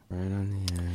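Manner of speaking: ominous hush. Pretend. Ominous quoted tweet.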